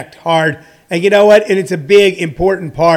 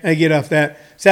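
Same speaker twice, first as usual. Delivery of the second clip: ordinary delivery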